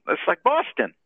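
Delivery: normal talking voice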